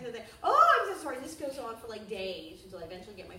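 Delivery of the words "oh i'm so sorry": loud, high, gushing mock apology; over-apologize like a panicked server; flustered waiter. Waiter brought the wrong order, loud high mock apology.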